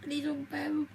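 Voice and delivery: weeping over a lost mother; real crying. Real, not acted. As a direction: sob the words out through real grief. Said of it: "And I didn't mean to cry".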